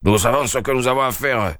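a touch of lofty dignity; sergeant, mildly majestic. Acted. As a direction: speak with the calm weight of the law. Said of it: "dit majestueusement le brigadier".

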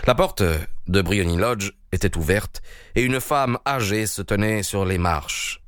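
ordinary delivery

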